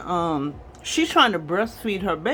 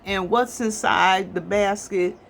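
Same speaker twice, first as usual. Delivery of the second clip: usual speaking voice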